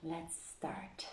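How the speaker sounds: breathy whispered cue; soft whispered let's start. Real, not acted. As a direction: whisper it before the story begins. Whispered start before the reading.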